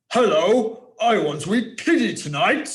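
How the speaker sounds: loud, high wolf bellow, far from his own voice. Shown as a demonstration. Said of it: "So the wolf bellowed".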